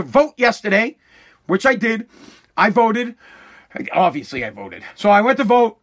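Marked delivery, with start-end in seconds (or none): none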